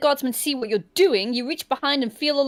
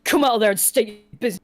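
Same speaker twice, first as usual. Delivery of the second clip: lightly voiced gruff guard, quick and a bit louder; 3 semitones lower, 4 dB louder, faster than usual. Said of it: "says a gruff voice".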